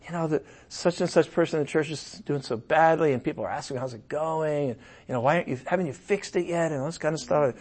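quiet fretting thoughts before prayer; anxious inner worry. Retold or imitated. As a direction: mutter the worries softly to yourself. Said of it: "when I'm just feeling like".

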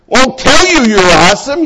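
normal talking voice

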